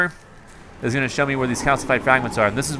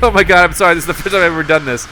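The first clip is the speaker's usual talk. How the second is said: laughing, louder, rushed sorry; laughing apology; 6 semitones higher, 7 dB louder, faster than usual. Retold or imitated. Player apologizing to waiting teammates, laughing. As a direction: apologize through laughter, embarrassed.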